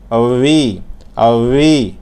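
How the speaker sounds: drawn-out "উই" with a smooth glide; smooth V sound. Shown as a demonstration. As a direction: say "উই" slowly, tongue lightly curled. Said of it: "একটু মসৃণ করে". (জিহ্বা আলতো ঘুরিয়ে মসৃণ "উই")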